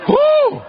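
high whoop of relief; relieved whoop. Retold or imitated. The fish whooping with relief after spitting Jonah out.

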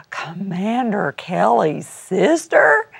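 gasp, then slow, drawn-out mock awe; slow, astonished question after a gasp; imitated amazement. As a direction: mimic a stunned fan's question. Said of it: "I get that all the time".

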